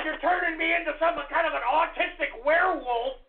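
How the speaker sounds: ordinary talk, nothing marked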